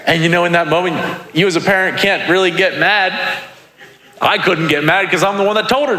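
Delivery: high-pitched, as if near tears; high comic voice. Acted. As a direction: squeeze it out high, mock-helpless. Comic high voice after crying story.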